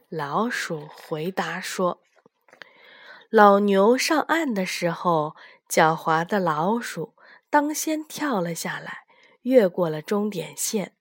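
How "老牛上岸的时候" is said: ordinary delivery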